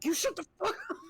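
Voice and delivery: high, half-laughing mock yell at a sibling; playful sibling yell, laughing. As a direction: slam the table and bark it, laughing. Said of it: "slam the table and be like".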